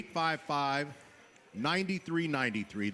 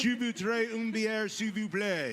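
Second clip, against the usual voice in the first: hammed-up French phrase, higher pitch; 6 semitones higher, slower than usual. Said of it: "Let me do it in French".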